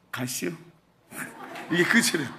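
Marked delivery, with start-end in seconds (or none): 0.1-0.5 soft, terse Chungcheong punchline; joking Chungcheong accent, kept low-key; Chungcheong dialect. Retold or imitated. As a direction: drop it like a Chungcheong local. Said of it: "충청도는 뭐라는지 아세요?".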